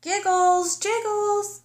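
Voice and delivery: high call for her family. Acted. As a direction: call far across the hillside. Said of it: "Tickles called".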